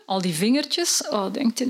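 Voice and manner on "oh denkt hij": usual speaking voice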